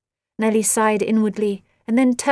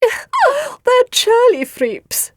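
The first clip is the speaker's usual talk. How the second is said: very high, slow, laughing hostess voice; 6 dB louder, much slower than usual. A narrator's reading. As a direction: laugh it out high, drawing the words out. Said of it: "laughed Agar".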